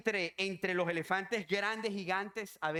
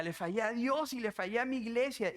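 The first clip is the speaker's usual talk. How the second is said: raised, pleading mock cry; imitated tearful confession; 5 semitones higher than usual. Woman tells pastor she failed her church, imitated crying. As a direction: repeat it like a weeping confession. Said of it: "Y decía llorando".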